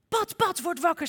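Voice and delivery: high, loud wake-up call; excited calling out. Acted. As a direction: rouse a sleeping friend. Kikker wakes sleeping Pat.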